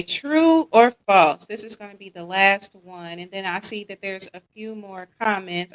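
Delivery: ordinary delivery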